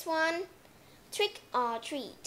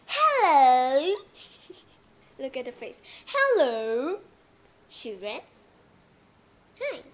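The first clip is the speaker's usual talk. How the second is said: house owner; 2 semitones higher, 10 dB louder than usual. Acted. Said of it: "when the owners of the house comes".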